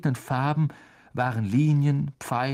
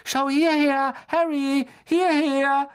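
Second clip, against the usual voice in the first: very high, louder eager fan's cry; a star-struck boy calling shrilly up to Harry; shrill call; 5 dB louder, slower than usual. A narrator's reading. Colin calling up to Harry from the stands, high and shrill. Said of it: "rief er schrill".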